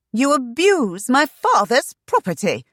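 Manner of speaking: high, loud outraged accusation. A narrator's reading. Shanna rebuking the abusive guard, loud and outraged.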